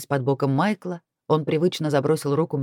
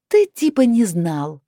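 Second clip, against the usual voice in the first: slow, louder, higher disbelieving drawl; open scepticism; 5 semitones higher, 5 dB louder, slower than usual. A narrator's reading. Heroine doubting Chris on the phone, openly sceptical. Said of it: "не скрывая скепсиса".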